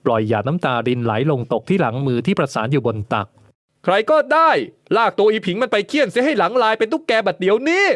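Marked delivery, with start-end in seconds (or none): 3.8-7.8 much higher, commanding shout to the servants; harsh shouted order. A narrator's reading. Lord ordering servants to whip her, harsh high shout. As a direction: bark the order, merciless. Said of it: "ประกาศเสียงกร้าว".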